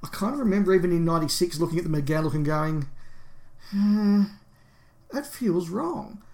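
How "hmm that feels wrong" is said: pondering, higher and drawn out; retold doubt. Fan recalling first look at McGann's costume, doubtful. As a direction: mull it over slowly, pitch raised in doubt.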